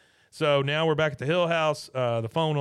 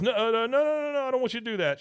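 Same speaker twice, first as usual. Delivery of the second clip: mock panic; 11 semitones higher, much faster than usual. Hank panics, retold.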